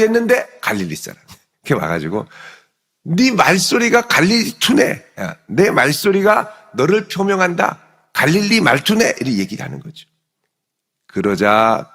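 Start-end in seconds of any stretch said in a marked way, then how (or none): 3.0-9.2 accusing. Acted. Bystanders exposing Peter by his Galilean speech, accusing. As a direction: catch someone out triumphantly.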